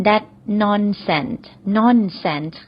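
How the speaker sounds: very slow, careful repeat; slow model phrase. Shown as a demonstration. Teacher repeats the phrase slowly for learners. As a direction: stretch every syllable so students can copy. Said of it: "เราก็ใช้สำนวนนี้ก็ได้ค่ะ".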